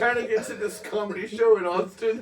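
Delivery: high, loud laughing caller; hammed-up caller. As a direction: whine it out high and giddy. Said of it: "make the nine one one call".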